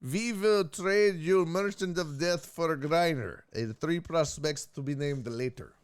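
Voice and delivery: put-on slow, heavy Russian accent. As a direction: read it like a Russian official, slowly. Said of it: "Who nailed the Russian accent".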